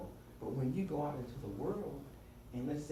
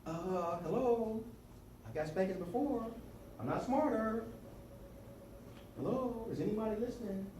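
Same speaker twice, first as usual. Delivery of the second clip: higher-pitched smart-aleck back talk; sarcastic kid; 9 semitones higher than usual. Acted. Boy reasoning with mother about spankings, sarcastic. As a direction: needle them like a child who is being ignored. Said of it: "I'm really sarcastic".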